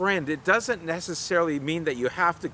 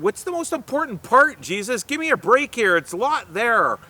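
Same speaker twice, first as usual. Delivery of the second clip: higher, pleading give-me-a-break voice; a mildly exasperated voice demanding the short version; exasperated questioner; 6 semitones higher, 3 dB louder than usual. Retold or imitated. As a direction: act out the impatient asker. Questioner pressing Jesus for answer.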